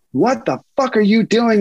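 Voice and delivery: high, outraged re-enacted yell; high-pitched retold scream. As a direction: yell it as you did in the fight. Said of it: "And I start screaming".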